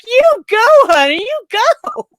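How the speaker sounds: very high, gleeful whoop of encouragement; cheering her on. Retold or imitated. Viewer rooting for the woman in the bar fight, gleeful cheering. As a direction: squeal it like a proud fan.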